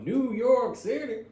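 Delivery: put-on high, slow, drawn-out old ad voice; cowboy salsa ad voice. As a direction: stretch it like an old salsa commercial. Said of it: "I say it with the accent, those old salsa commercials".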